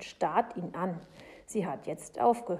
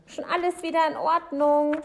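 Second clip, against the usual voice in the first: loud, raised call; 9 semitones higher, 9 dB louder than usual. A narrator's reading. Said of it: "ruft er dann".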